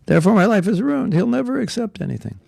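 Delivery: imitating a low self-esteem devotee giving up; mock despairing devotee. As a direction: play the self-doubter in a mild mock lament. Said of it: "all the low self-esteem people think".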